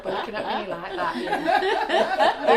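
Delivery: high-pitched, helpless real laughter; cracking up mid-sentence; laughing. Real, not acted. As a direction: squeeze the words out through laughter. Laughing at teasing colleague.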